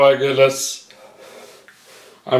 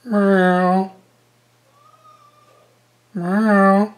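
cat meow; 7 semitones higher than usual. Pretend. Meowing back at the cat.

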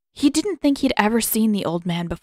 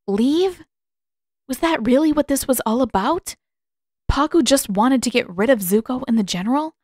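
shocked thought; 5 semitones higher, 3 dB louder than usual. A narrator's reading. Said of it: "Katara's breath caught".

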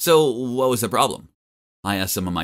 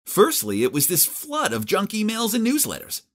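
ordinary delivery, nothing marked

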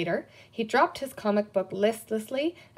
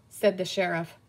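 ordinary delivery

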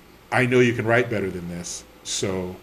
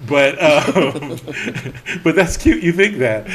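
loud laughing, pitched up; 5 semitones higher, 8 dB louder, slower than usual. Retold or imitated. Teasing the editor, loud and laughing. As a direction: laugh it off at him.

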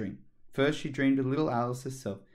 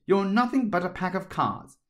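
defiant; 8 semitones higher, 5 dB louder than usual. A narrator's reading. Alice defying the court.

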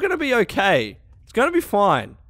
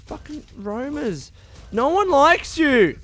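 ordinary talk

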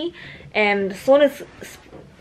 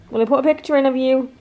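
usual speaking voice

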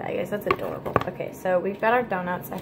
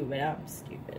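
whispering; 6 semitones lower, 9 dB quieter, slower than usual. Real, not acted. Self-deprecating aside to partner.

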